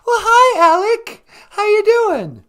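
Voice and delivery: mock sweet old lady greeting; high falsetto greeting. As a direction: gush a shrill hi at him.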